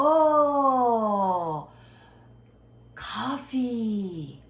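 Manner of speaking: long high, loud drawn-out "oh" of understanding; exaggerated recognition. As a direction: act a student getting it at once, stretching each word. Acted student understanding one word. (알아들은 듯 길게 늘여 과장한 감탄)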